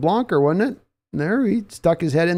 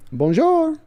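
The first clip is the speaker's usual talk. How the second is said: Bonjour sung out much higher in a mock French lilt; mock French greeting; 12 semitones higher than usual. Shown as a demonstration. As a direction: trill Bonjour high like a playful Frenchman.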